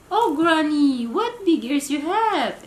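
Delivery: high, loud cry of surprise; girl amazed at Granny's big ears; surprised exclaim. Acted. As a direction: gasp it out amazed.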